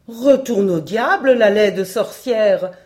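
loud, slow shout at the witch; shepherd rebuking the witch, shouting; furious cry. Acted. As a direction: hurl it at her with full force. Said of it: "s'écria-t-il".

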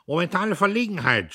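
high, loud rattling officer snarl; rasping snarl. A narrator's reading. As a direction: rattle it off curtly. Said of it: "schnarrte er".

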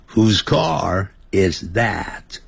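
非常慢示范 Whose car is that; 一字一字拉长问; very slow model question. Shown as a demonstration. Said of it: "那又是谁的车呢". (慢慢示范问句)

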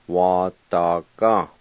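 "Wa da ga" said slowly, each syllable clear; slow model word. Shown as a demonstration. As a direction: draw out "Wa da ga" for learners. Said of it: "I'll go back and pronounce them".

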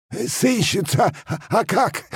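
very high, drawn-out reply; answering peasant. A narrator's reading. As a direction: call back stumblingly in a high voice. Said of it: "откликнулся тот". (мужик откликается высоким голосом)

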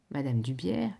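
ordinary delivery, nothing marked

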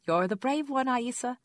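put-on high, loud praise for a small child; pleased, lifted voice. A narrator's reading. Mother praises her toddler in the tub, pleased lifted voice. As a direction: lift the voice well up to show delight. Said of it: "I lifted my voice a half octave so she'd know how pleased I was".

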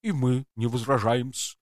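a touch of gurgle, alien; gurgling. Acted. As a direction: bubble the words out wetly. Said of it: "пробулькал Кхулта".

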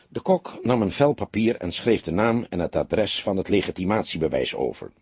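ordinary delivery

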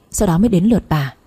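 usual speaking voice

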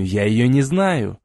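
usual speaking voice

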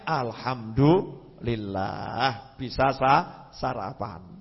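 low, very slow thankful murmur; "Alhamdulillah" stretched out slow and low, gently grateful. Retold or imitated. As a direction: savour each word of thanks.

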